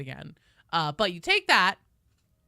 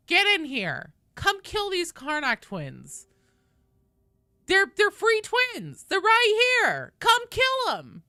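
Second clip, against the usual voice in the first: loud, high, slow calling out; drawn-out hype call; 9 semitones higher, 5 dB louder, slower than usual. Real, not acted. Calling viewers to join.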